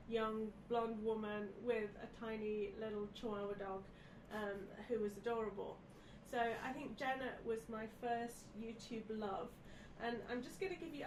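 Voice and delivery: ordinary talk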